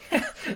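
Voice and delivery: high monster cackle; gloating evil chuckle; villain laugh. Acted. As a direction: laugh like a gleeful alien villain. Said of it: "Carnage laughs".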